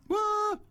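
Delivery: high sweeping whistle call; imitated police whistle. Shown as a demonstration. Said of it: "They went".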